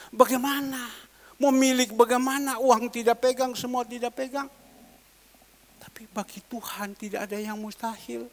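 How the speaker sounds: ordinary talk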